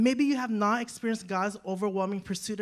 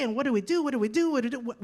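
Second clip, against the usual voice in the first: ordinary talk, nothing marked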